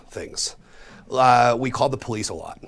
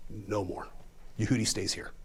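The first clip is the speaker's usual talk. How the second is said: imitating mom laying down the law, softer; stern angry mom; 2 semitones lower, 9 dB quieter than usual. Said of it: "I've ever seen my mom this mad".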